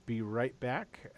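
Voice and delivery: ordinary delivery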